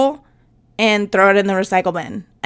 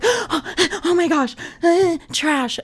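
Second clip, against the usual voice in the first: mock panic; 7 semitones higher, much slower than usual.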